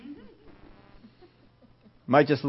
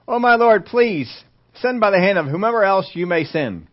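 pleading; 5 semitones higher than usual. Acted. Moses begging God to send someone else, pleading.

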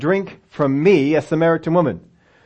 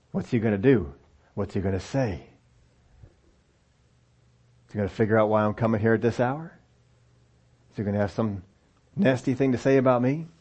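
softer, lower, worried musing; anxious inner thoughts; 4 semitones lower, 6 dB quieter than usual. Retold or imitated. Preacher voicing the woman's worried thoughts at the well, soft and low. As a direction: wonder it to yourself, uneasy. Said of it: "she's thinking about what's going to transpire".

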